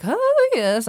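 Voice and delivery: high, lilting mock posh English; playful posh accent. Shown as a demonstration. Coach trying out a playful posh English accent. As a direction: swoop up and down like a toff. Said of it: "start to play with accents".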